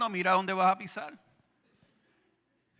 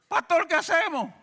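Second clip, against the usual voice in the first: 1 mock panicked brother; 10 semitones higher, 6 dB louder than usual. Brethren asking pastor, panicked.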